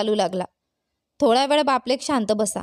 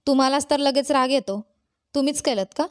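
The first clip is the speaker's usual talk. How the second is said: plain reading voice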